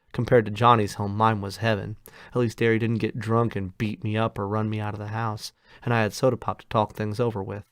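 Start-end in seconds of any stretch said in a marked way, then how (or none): none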